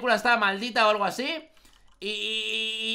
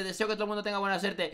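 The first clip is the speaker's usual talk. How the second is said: ordinary talk, nothing marked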